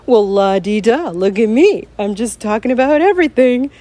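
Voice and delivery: smug la-di-da, sing-song and breezy; mock carefree. Podcaster mocking a carefree version of herself, smug sing-song. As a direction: play a show-off who has no worries. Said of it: "I get on this and record and go".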